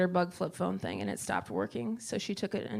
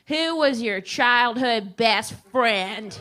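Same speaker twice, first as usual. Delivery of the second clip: slow, louder robotic agent question; Sprint agent; 2 semitones higher, 7 dB louder, much slower than usual. Retold or imitated. Sprint rep quizzing mom, slow loud agent voice. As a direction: drag out the security question like a phone rep.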